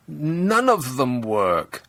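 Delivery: drawn-out flat verdict; slow punchline. Pretend. Comedian summing up government computers, slow and deadpan. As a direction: land each word slowly.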